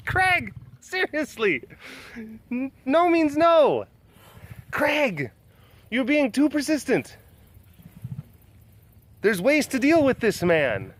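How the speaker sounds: high-pitched, playful telling-off; mock scolding. Telling Craig his lady needs a rest, mock scolding.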